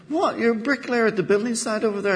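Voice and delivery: high-pitched, quick disbelief; incredulous barman. Acted. Barman hears the penguin's job, acting incredulous. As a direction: jump up high on the question, then rattle on.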